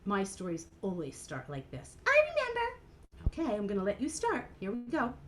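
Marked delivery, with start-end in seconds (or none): 2.1-2.7 much higher, eager little puppet voice; a shy little puppet, thrilled; Mimi puppet voice. Acted. Puppet Mimi telling Ellen she loves new friends, high and eager.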